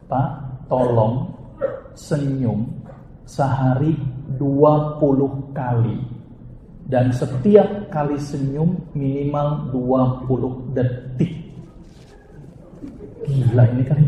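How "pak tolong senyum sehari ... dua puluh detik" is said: slow prescription. Retold or imitated. Doctor prescribes smiling slowly.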